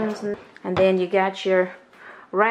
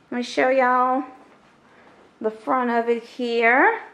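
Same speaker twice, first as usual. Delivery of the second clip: usual speaking voice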